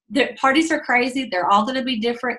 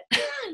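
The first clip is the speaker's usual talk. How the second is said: high giggle; 7 dB quieter than usual. Real, not acted. Laugh after joke.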